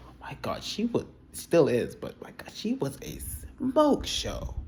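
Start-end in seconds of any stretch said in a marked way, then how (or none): 0.1-0.6 breathless whispered awe; awed whisper. Real, not acted. Whispering in awe over Valerie's looks. 1.9-4.5 breathless whispered awe; awed whisper. Real, not acted. Whispering in awe over Valerie's looks.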